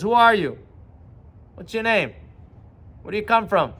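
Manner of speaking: lightly voiced king of the jungle, pressing; lion questioning. Lion confronts the strange dog, demanding who he is.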